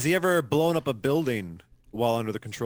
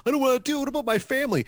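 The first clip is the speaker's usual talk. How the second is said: high-pitched, hammed-up pleading; much higher voice, playing at panic; mock reluctant protest; 12 semitones higher, much faster than usual. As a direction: plead as an unwilling young hero. Acting reluctant Spider-Man's protest.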